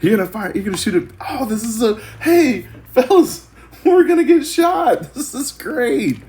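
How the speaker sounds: very high and loud, laughing mock glee; hammed-up delighted victim laughing; upbeat movie guy. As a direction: cheer about getting shot. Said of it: "It's like".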